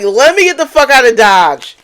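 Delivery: mock urgent exit. Reader voicing the viewer's escape, loud mock urgency. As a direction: act out bolting for the door. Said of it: "You was like".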